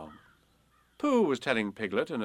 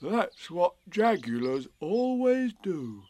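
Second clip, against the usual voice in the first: slow, higher, drawn-out bear voice; much interested Pooh; 7 semitones higher, 3 dB louder, much slower than usual. A narrator's reading. Pooh informing Piglet, slow interested voice. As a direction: explain slowly with great interest. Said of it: "said Pooh, much interested".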